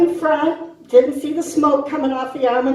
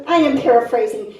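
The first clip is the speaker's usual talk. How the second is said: normal talking voice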